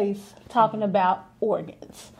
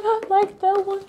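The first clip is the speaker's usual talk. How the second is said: high, teary little voice; mock-tearful pick at the funeral home; tearful imitation; 9 semitones higher than usual. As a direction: imitate yourself choking out a choice through tears. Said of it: "I am in tears".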